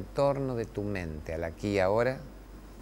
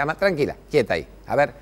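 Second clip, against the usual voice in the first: louder, steady fatherly instructions; Spanish: papá firme y calmo; calm firm father; 2 semitones higher, 7 dB louder than usual. Retold or imitated. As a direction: coach a climbing child to keep balance. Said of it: "Yo decía".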